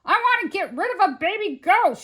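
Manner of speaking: loud, high exasperated shout. A narrator's reading. Museum man to puzzled police on phone, loud shout. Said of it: "shouted Mister Frizzle".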